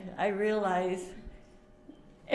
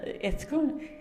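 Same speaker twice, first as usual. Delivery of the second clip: higher, breaking with tears and laughter; choked up; 7 semitones higher, 4 dB louder than usual. Real, not acted. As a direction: fight back tears while you speak. Tearful at a memorial.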